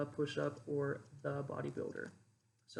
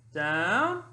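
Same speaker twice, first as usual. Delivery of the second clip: "down" called with a loud upward swoop; rising inflection; 3 semitones higher, 10 dB louder than usual. Shown as a demonstration. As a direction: sweep "down" upward like a last-rep call. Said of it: "that's a two count exercise inflection".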